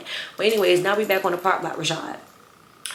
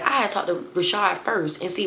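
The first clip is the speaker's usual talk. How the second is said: normal talking voice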